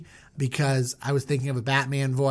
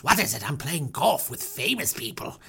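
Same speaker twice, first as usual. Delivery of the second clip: softer put-on Pharaoh voice; irritated Pharaoh; 2 semitones lower, 4 dB quieter than usual. Pharaoh interrupted mid-game, put-on soft pomposity. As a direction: brush off the servant in character.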